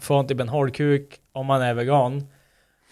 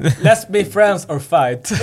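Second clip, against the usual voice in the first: slow, drawn-out mock English line; mock tough challenge; 3 semitones higher, much slower than usual. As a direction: spell it out slowly like a cheesy tough guy. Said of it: "Det är såhär". (långsam, överdriven engelsk replik)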